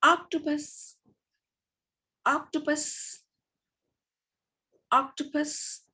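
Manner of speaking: octopus said high and quick, a short o; clipped short vowel. Shown as a demonstration. Teacher showing pupils the short o in octopus. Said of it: "And the second is".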